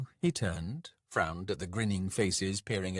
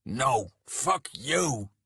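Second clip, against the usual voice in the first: slow, drawn-out angry bellow, higher pitched; 4 semitones higher, 4 dB louder, much slower than usual. A narrator's reading. Man answering his angry lover's curse, bellowing. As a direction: bellow it back, stretching each word. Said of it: "he bellowed".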